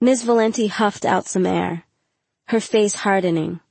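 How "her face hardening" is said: usual speaking voice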